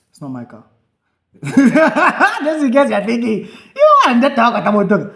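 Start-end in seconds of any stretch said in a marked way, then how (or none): 1.3-5.1 talking through real laughter, high and loud; laughing. Real, not acted. Telling his friend it isn't his car, laughing. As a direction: laugh your way through the joke.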